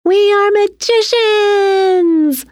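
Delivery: high, drawn-out jubilant shout. A narrator's reading. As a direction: cry it out high, holding the last word long. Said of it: "Tina shouted".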